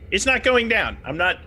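sudden high, loud panic; panicked protest. Acted. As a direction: blurt it out in a rising panic. Player panics in character.

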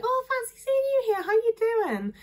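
very high, sing-song hello; gushing greeter. Retold or imitated. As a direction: squeal a delighted greeting at a passing acquaintance. Acts out a friendly street greeting.

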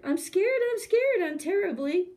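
hammed-up fright, high then dropping; terribly scared. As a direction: squeal it like a trembling cook. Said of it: "the cook declared".

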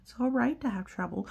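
ordinary delivery, nothing marked

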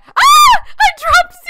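high, loud yelp of alarm; panicked shriek. Acted. Player drops their pet human in the game, panicked shriek. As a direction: cry out at full pitch as it slips.

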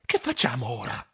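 hushed low question; whispered. Acted. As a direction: whisper so the pirates cannot hear. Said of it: "Sussurrai a Cionfolone". (sussurrando piano)

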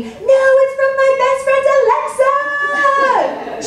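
mock yell of a thrilled small girl; excited child yell. Mom voicing daughter yelling down the stairs, high excited child shout. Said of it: "comes running down our stairs screaming".

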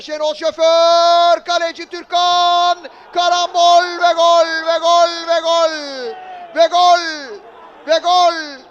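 long loud stretched goal call; screaming goal call. Real, not acted. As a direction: roar the goal, stretching every word. Goal called by commentator.

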